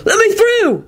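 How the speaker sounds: high commanding yell. A narrator's reading. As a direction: shout through the crowd. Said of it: "William yelled".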